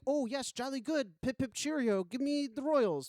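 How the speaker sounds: mock British accent. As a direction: play a cheery British toff in a mock accent. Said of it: "I'd be like".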